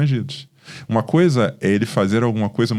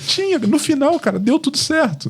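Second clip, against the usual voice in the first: amused relief. Real, not acted. Laughing relief after church story.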